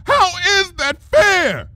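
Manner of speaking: slow, high, shouted repeat; raging shout. A narrator's reading. Luna raging at Trixie over her sister, shouting.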